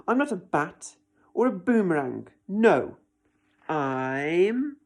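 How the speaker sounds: loud, building frustrated list; frustrated protest. Acted. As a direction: protest it with rising anger.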